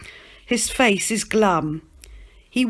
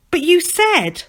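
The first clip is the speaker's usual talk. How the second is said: protesting Stan; 10 semitones higher, 5 dB louder than usual. Acted.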